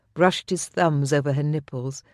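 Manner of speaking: ordinary delivery, nothing marked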